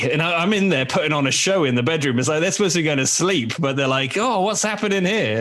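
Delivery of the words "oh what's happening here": high-pitched imitation of curious children; mock childish curiosity, pitched up; curious kids. Kids roused at bedtime by dad's show, high curious voice. Dad voicing his excited children.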